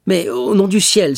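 high, loud, desperate appeal; distressed cry. A narrator's reading. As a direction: cry out and beg to be believed. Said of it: "s'écria le malheureux jeune homme". (cri de détresse)

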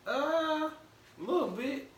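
very high squeaky mock-modest answer; squeaky playful "a little bit"; coy reply. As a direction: play it up like a shy little admission. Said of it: "And I'm like".

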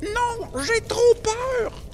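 very high, slow scared voice; a tiny voice frozen with fear; frightened child. Acted. Little brother refusing, afraid. (enfant apeuré, voix très aiguë et lente)

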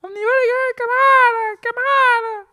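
very high, loud, squeaky call to comrades; rally the troops in a tiny high voice; high squeaky union leader shouting; squeaky rallying cry. Retold or imitated. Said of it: "d'un coup".

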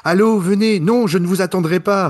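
high, loud wooden phone call; bad acting. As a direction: ham it up like a bad actor. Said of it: "qu'est-ce qu'il joue mal quand il parle au téléphone". (jeu d'acteur raté, voix aiguë et forte)

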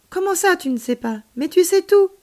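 high, rising to a loud outburst; incredulous protest. A narrator's reading. Child refusing alien's admission of not knowing, incredulous. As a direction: object in childlike disbelief.

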